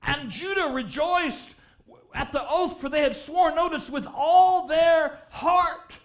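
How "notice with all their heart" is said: slow, loud stress on heart; drawn-out stress on heart; emphatic. Real, not acted. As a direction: lean hard on with all their heart. Preacher stresses the phrase.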